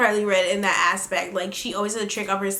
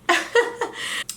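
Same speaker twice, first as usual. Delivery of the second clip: breathy giggle up high; high airy laugh; 10 semitones higher than usual. Real, not acted. Laughing at her own insult of the girl. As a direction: laugh under your breath.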